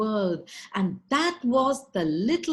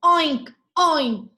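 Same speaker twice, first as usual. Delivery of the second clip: loud furious pig snorts, much louder; angry oinks; 6 semitones higher, 9 dB louder than usual. Acted. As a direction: bellow two enraged oinks. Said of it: "he too lost his temper".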